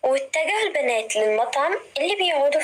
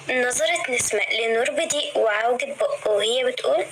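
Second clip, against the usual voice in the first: ordinary delivery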